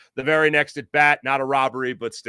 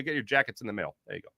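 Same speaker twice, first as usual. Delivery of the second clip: usual speaking voice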